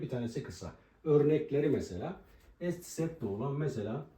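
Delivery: ordinary delivery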